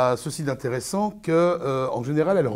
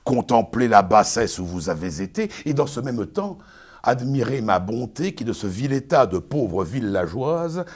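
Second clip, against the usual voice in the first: pompous Arnolphe, lecturing his bride; booming, sermonizing stage declamation; hammy stage actor; 4 dB louder than usual. Acted. Performs Arnolphe's tirade.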